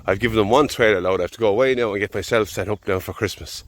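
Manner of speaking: ordinary delivery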